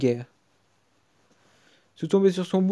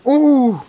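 high loud "ouh" of surprise; sudden thrilled hoot; excited whoop; 8 semitones higher, 9 dB louder than usual. Real, not acted. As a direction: yelp at the reveal. Big card revealed.

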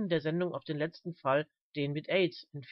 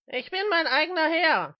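high, loud defiant roar; shouted declaration of independence; bellowing; 11 semitones higher, 7 dB louder than usual. Acted. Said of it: "brüllte Mister Smith".

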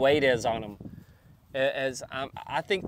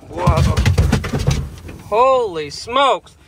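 startled exclamation; 2 semitones higher, 7 dB louder, much slower than usual. Real, not acted. Startled by charging cow.

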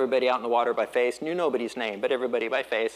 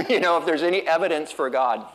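ordinary delivery

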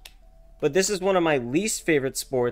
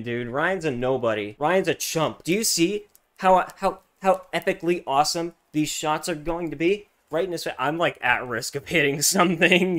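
usual speaking voice

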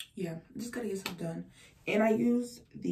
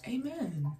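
prayer; 11 dB louder, slower than usual. Real, not acted. Praying aloud for viewers.

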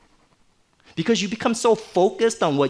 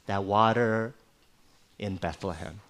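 low, fading, much softer longing murmur; wistful sigh; 7 semitones lower, 5 dB quieter than usual. Retold or imitated. David longing for the well water, softer and sighing. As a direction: speak it on a dying sigh of longing. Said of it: "He just sighs".

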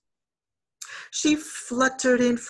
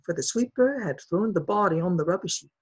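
plain reading voice

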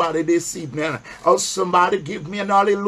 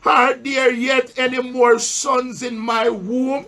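slow, raised reading of the Bible verse; solemn pulpit Bible reading; scripture reading; 5 semitones higher, slower than usual. Acted. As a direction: read the verse slowly to the church, pausing for answers. Preacher reads scripture aloud.

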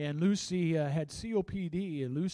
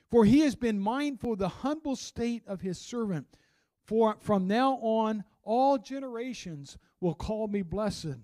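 a preacher's raised, deliberate scripture read; 3 semitones higher, 7 dB louder than usual. Acted. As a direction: proclaim the verses to the congregation. Reading Mary's song.

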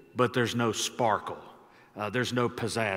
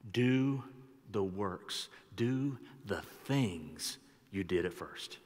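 quiet recital; 4 semitones lower, 7 dB quieter, slower than usual. Acted. Soft repeated scripture recital.

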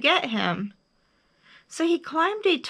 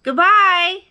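high, loud farewell; big high-pitched call; 9 semitones higher, 11 dB louder than usual. A narrator's reading. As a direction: holler goodbye across the mountains. Bear calls across to the moon.

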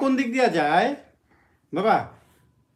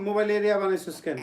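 ordinary talk

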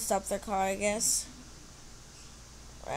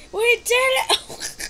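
high, loud, slow victory shout; triumphant cheer; 12 semitones higher, 12 dB louder, much slower than usual. Real, not acted. Player celebrating the finish, high triumphant shout. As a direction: stretch out the win.

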